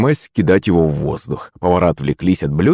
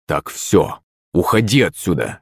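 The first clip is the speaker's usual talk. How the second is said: usual speaking voice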